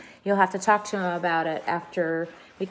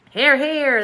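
higher, louder group cheer; shouted; 8 semitones higher, 8 dB louder than usual. A narrator's reading. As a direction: cheer it out together. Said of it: "they all shouted".

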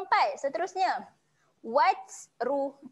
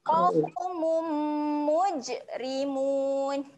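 slow chant with nasal hum on mum; recited; Quran recitation; much slower than usual. Shown as a demonstration. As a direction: hum the m, stretch mujrimun. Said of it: "Mum dia ada bacaan dengung".

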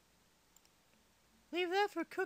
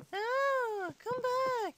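higher pleading call, louder; calling after pig; 6 semitones higher, 3 dB louder, much slower than usual. Real, not acted. Chasing a pig that got away, higher pleading call. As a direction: call the runaway pig back.

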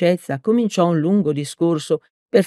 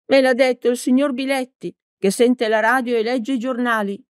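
put-on higher old lady voice, reproachful; a lightly voiced old woman accusing; stern old teacher; 7 semitones higher than usual. A narrator's reading. Old teacher accusing Peppone, mildly stern.

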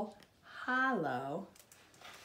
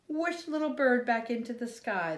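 plain reading voice

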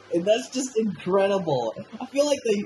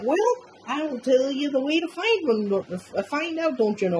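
put-on light, higher Minnesotan accent, cheery; 10 semitones higher than usual. Marge answering cheerfully in a mild Minnesotan accent. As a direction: chirp it out in a folksy Minnesota accent. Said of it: "she's got a little Minnesotan accent".

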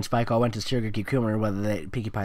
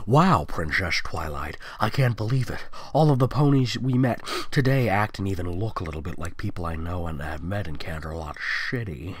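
a lightly voiced lower teen girl, impressed; hint of a lower girlish voice, amazed; Sunset Shimmer voice; 6 semitones lower than usual. Reader voicing Sunset Shimmer to Twilight, lightly lower and amazed.

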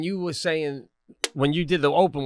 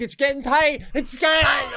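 higher, louder panic as it squeezes; mock panic; 9 semitones higher, 3 dB louder than usual. Imagining panic as the machine closes in, high and loud. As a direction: cry out that it's tightening. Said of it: "I'm like".

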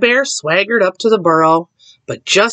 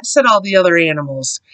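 plain reading voice

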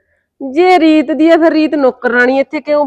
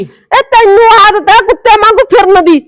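higher, louder furious comeback; shouted insult; 6 semitones higher, 5 dB louder than usual. Acted. Mother hurling the dog insult back at her, shouting. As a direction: fire the insult straight back.

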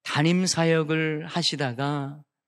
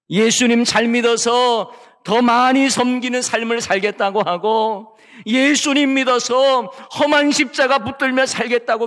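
higher, louder fervent call to commitment; fervent preaching; 6 semitones higher, 6 dB louder than usual. Acted. Pastor urging believers to bear the cross, higher fervent preaching. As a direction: lift the congregation toward the cross.

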